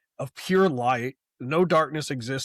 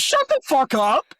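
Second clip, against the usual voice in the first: much higher, louder fed-up yell; annoyed shout; 12 semitones higher, 7 dB louder than usual. Retold or imitated. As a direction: yell it at the character like he can hear you. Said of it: "And I'm like".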